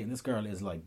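normal talking voice

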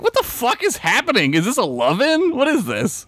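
high, incredulous outburst; bewildered Spock. Retold or imitated. Spock baffled by the hippies, high incredulous shriek. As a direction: pitch way up in shocked disbelief.

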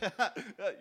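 fake laugh. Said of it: "and you're like".

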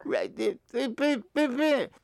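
high, pleading mock insistence that falls apart; hammed-up denial, stammering at the end; adamant. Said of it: "I would be becoming adamant about it".

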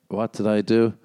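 usual speaking voice

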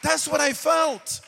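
loud, building preacher's declaration; impassioned preaching. Real, not acted. Pastor testifying to congregation how community embraced him, impassioned preaching. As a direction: proclaim it at full force, driving each phrase.

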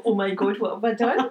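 half laugh, half horror; laughing dismay. Retold or imitated. Telling the listener about the booked pavilion, laughing in mock panic.

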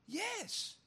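high, strange little voice; a put-on high yes; mock squeaky yes. Answers own rhetorical question in voice.

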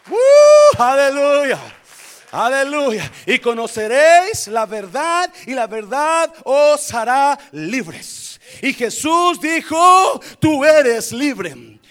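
loud, high, fired-up preaching; preaching at full force. Real, not acted. Pastor preaching freedom to congregation, loud and high. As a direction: shout it out and sing the promise to the church.

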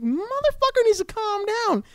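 very high squeaky put-on voice; mock shrieking thought. Guy reacting to pushy lady, squeaky high voice. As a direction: squeal it in a cartoon voice. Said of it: "I'm like".

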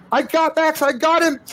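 put-on high, squealed girl's shout of success; very high-pitched, strained exclamation; triumphant cry. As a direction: cry out in breakneck rescue excitement. Game master voices girl grabbing Max.

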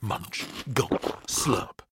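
softly whispered munching; whispered eating sounds. A narrator's reading. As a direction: breathe the noises out.

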